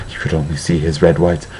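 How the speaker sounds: ordinary delivery, nothing marked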